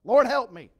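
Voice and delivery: loud, high cry for help; drowning man's shout. Acted. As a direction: yell it like you're going under. Peter drowning cries out.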